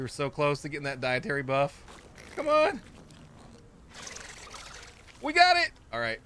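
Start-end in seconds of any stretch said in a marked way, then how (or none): 2.4-5.7 high, loud cheer; excited shout. Real, not acted. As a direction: yell it in triumph. Excited win in game.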